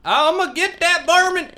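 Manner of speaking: loud comic hunting threat; hammed-up varmint hunter. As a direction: yell like a cartoon backwoods hunter. Acts out the armadillo shooter.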